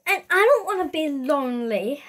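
normal talking voice

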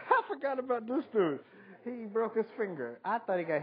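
high, loud, talking through laughter; laughing. Real, not acted. Remembering the contestant who broke his finger, laughing loudly. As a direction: laugh as you remember this guy.

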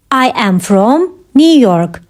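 slow, pause before "New York"; measured, careful delivery; slow teaching repeat. Shown as a demonstration. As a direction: separate the parts for learners. Teacher repeats answer slowly for learners.

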